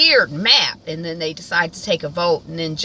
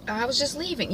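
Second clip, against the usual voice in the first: higher and softer, hesitant; soft shy Kate; 7 semitones higher, 6 dB quieter than usual. Retold or imitated. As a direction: murmur it shyly. Voicing Kate's reply.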